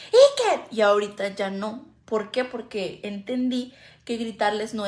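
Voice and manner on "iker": yelled name, much higher and louder; shout. Retold or imitated. Mother yelling her son's name, loud shout. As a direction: bellow his name across the house. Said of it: "antes era muy gritona con él".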